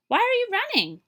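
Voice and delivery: loud, high call. Acted. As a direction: call out from the marsh. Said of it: "she called out".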